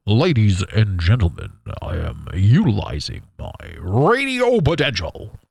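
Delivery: slow radio announcer voice; smooth radio host delivery. Pretend. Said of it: "Do you like my radio voice?".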